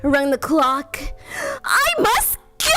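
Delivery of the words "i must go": put-on high, slow girlish voice; a high, hurried young girl; Cinderella. A narrator's reading. Cinderella to the prince, high and slow. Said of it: "said Cinderella".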